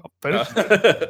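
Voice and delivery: lightly voiced John Goodman; John Goodman impression. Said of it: "sounded like you a minute ago".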